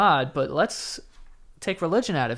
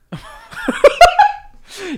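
big laugh; 8 dB louder than usual. Real, not acted. Laughs at own slip.

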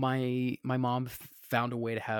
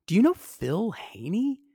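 slow, higher, careful asking; tentative question; 8 semitones higher, 5 dB louder, slower than usual. Retold or imitated. Son asking a star about his father, tentative. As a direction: feel it out slowly, lifting the pitch.

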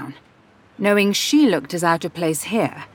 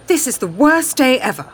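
high, louder cry of frustration; dramatic, fed-up complaint; exasperated outburst; 7 semitones higher, 5 dB louder than usual. A narrator's reading. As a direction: burst out with mock despair, pitch jumping up. Stranger bursts out complaining nearby.

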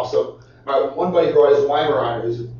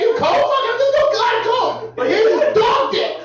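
mock high voice. Mock voicing at camp.

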